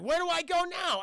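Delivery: high, loud plea of confusion; mock bewilderment. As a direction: play the hero hopelessly lost. Mocking lost hero's plotless confusion.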